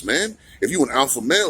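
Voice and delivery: normal talking voice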